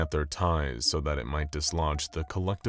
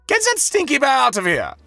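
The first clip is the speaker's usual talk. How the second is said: panicked shriek, much higher; shouting; 11 dB louder than usual. A narrator's reading. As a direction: scream at the assistant. Board member shouting in panic.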